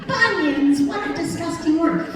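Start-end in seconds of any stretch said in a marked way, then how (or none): none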